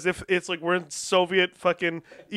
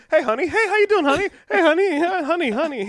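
imitated TV character; 8 semitones higher than usual. Imitates TV character greeting everyone honey.

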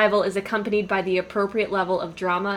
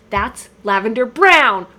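mock outrage; 2 semitones higher, 8 dB louder, slower than usual. Quoting the show's outraged line.

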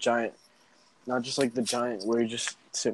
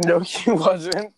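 laughing; 5 semitones higher, 8 dB louder, much slower than usual. Real, not acted. Laughing slow.

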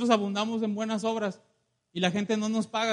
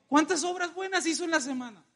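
high-pitched, pointed question; challenging question; 6 semitones higher than usual. Real, not acted. Preacher quizzes congregation on their week, high challenging question. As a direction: put the congregation on the spot, high-voiced.

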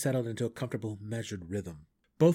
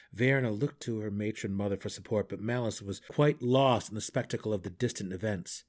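ordinary delivery

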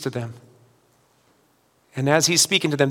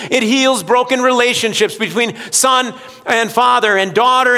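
raised preacher's voice, driving each line home; full-force preaching; 8 semitones higher, 6 dB louder than usual. Real, not acted. Pastor proclaiming what living water does, preaching hard. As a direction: lift it high and loud like a revival call.

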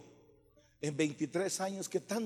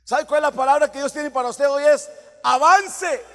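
loud proclamation; 8 semitones higher, 16 dB louder than usual. Real, not acted. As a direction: cry God's word out to the congregation. Proclaims God's word to congregation.

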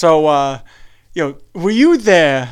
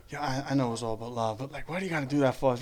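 much softer, lower, hurt-friend voice; sheepish quiet plea; 5 semitones lower, 16 dB quieter, faster than usual. Retold or imitated. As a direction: come back mild and wounded. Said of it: "he'll come over and say".

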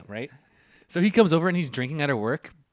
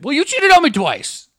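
high, loud, slow indignant retort; retold bickering; 13 semitones higher, 8 dB louder, slower than usual. Boyfriend throwing the accusation back, indignant high yell. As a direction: fire it back as a whiny yell. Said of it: "he's like".

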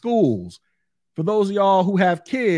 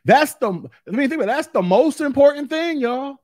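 emphatic rise; 6 semitones higher, 4 dB louder than usual. Real, not acted. Insisting to viewers.

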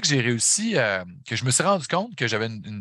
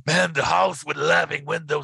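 slow, higher, rough-voiced rasp, lightly done; 5 semitones higher than usual. Singer fresh off stage repeating the film title, lightly imitated rough rasp. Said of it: "avec sa voix rocailleuse".

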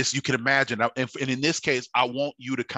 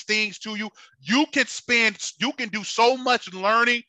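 high, loud preaching climb; preaching at full force; 9 semitones higher, 3 dB louder than usual. Real, not acted. Preacher warning the congregation, rising and impassioned. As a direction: build the sermon to a fever pitch.

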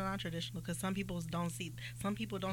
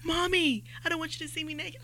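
put-on higher, louder little-boy voice; imitating her son; 10 semitones higher, 8 dB louder than usual. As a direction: play the four-year-old, earnest and innocent. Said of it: "that's literally what he said".

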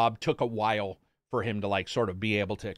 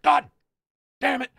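much higher, fast, louder ranting; imitating furious dad; 12 semitones higher, 5 dB louder, faster than usual. Son imitating his father cursing the broken neck, fast mock rant. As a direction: rattle it off like an outraged old man. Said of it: "It's just like".

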